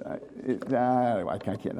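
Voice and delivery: ordinary delivery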